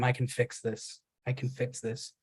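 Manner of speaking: hushed, broken-down repeat; fading repetition. A narrator's reading. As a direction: repeat it like a dying mantra. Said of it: "repeating the whole time".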